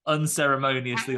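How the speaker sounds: ordinary talk, nothing marked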